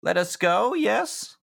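put-on anxious young hostage. GM voices scared NPC, higher.

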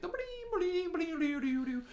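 high sung jingle imitation; mimicking game music. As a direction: sing the chime melody high. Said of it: "you hear Entei show up in that awesome music".

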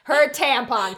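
loud insistent repeat; horrified insistence. Real, not acted. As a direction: hammer it home loudly. Repeats horrified correction to co-host.

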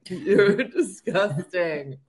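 slow, laughing mock disgust. Real, not acted. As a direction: scold him while cracking up. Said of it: "mom laughs at his joke".

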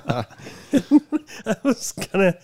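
words broken by laughter; slow, laughing through it. Real, not acted. Laughing while retelling fall.

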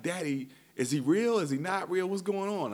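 imitating his confused kids; kids' voices. Dad voicing his daughters asking about Santa, high and puzzled.

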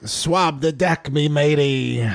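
slow, drawn-out pirate talk. Shown as a demonstration. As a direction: stretch it out like an old pirate. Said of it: "How do you talk like a pirate?".

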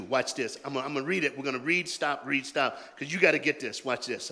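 usual speaking voice

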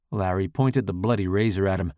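ordinary delivery

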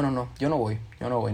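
normal talking voice

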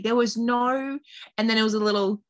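ordinary talk, nothing marked

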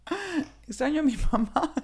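retold tearful cry, half-hammed; imitating crying. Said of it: "Y de repente yo lloraba y decía".

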